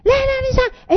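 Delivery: put-on a child arguing back with the parent, high and insistent; nagging child. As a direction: plead like a stubborn kid. Child pleads in acted quarrel.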